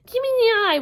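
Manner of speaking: high, loud desperate demand; wailing yell. Acted. Wasp demanding the lost eye, wailing yell. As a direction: wail for it at full volume. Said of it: "Wasp yelled, wailed".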